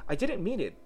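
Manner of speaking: high, apologetic plea; pleading. A narrator's reading. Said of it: "pleaded poor Alice".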